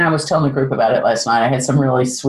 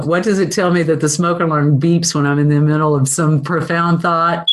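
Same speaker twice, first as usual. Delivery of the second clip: usual speaking voice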